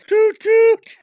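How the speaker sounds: high loud toot toot; bus horn toot. Pretend.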